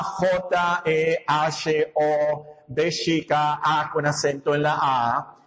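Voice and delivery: slow, low, letter-by-letter spelling; deletreando. Shown as a demonstration. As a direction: spell it out slowly, one letter at a time. Deletrea el nombre Jehová. (deletreando despacio, letra por letra)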